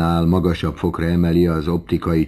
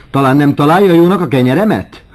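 sharp, high, loud landlady's challenge; éles hang; 10 semitones higher, 10 dB louder, faster than usual. A narrator's reading. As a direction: shout over clattering spoons and plates. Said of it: "szólt rá Vokéné éles hangjával". (éles, magas, túlharsogó hang)

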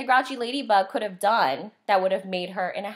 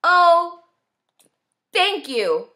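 tired ladybug; 8 semitones higher, 6 dB louder, much slower than usual. A narrator's reading. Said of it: "said the wet, tired, and hungry ladybug".

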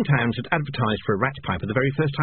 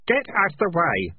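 mild shout; 7 semitones higher than usual. A narrator's reading. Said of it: "shouted Keith".

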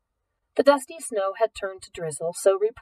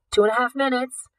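warning; 5 semitones higher, 5 dB louder than usual. A narrator's reading. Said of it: "Simon warned".

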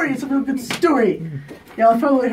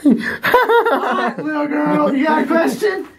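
laughing mock interviewer; 5 semitones higher, much slower than usual. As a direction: tease the friend like a pushy talk-show host.

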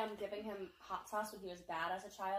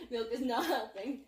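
laughing; 2 semitones higher, 6 dB louder, slower than usual. Real, not acted. Mouth burning from gummy.

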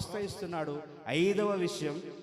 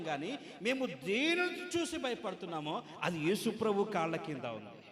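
normal talking voice

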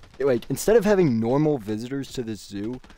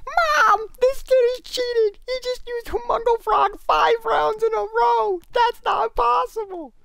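put-on tattle to mom like a five-year-old, dragging the words out; whiny five-year-old; slower than usual. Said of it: "The little five-year-old".

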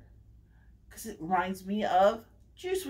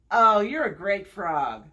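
ordinary talk, nothing marked

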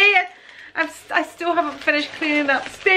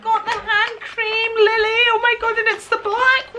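higher, louder, giddy with a laugh; thrilled call; 7 semitones higher, 4 dB louder than usual. Real, not acted. Calling to Lily about the hand cream, thrilled and laughing. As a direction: squeal it out to Lily.

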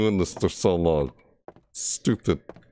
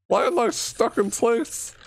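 frightened. Real, not acted. Stuck in place in horror game.

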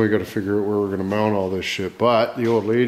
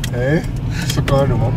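ordinary talk

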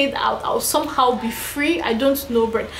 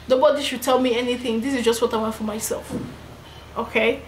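normal talking voice

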